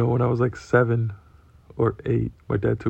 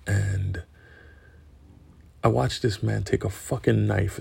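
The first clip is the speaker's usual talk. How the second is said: normal talking voice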